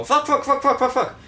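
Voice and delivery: high, loud rapid-fire swearing; spray out curses in a silly high yell; mock swearing burst. Gamer parodying his on-camera swearing, high mock yell. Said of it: "I just go".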